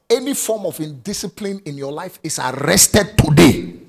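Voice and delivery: loud, high prayer shouted out. Acted. Preacher praying over the congregation, loud and high. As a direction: pray it out with all your force. Prays at full force.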